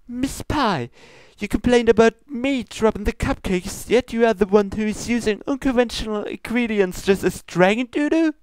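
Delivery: high, formal princess scolding; lofty royal reproach, pitched high. Acted. Luna scolds Pinkie about her ingredients, high and prim.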